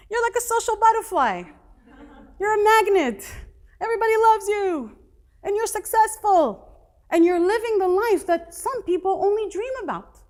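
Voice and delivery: put-on excited girlfriend. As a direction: gush with eager enthusiasm. Girlfriend voiced high and loud.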